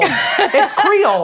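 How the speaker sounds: slow, high Louisiana Creole drawl; It's Creole drawn out slowly, high; Louisiana accent. Shown as a demonstration.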